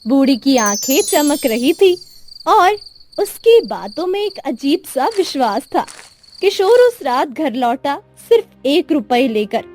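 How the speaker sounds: ordinary delivery, nothing marked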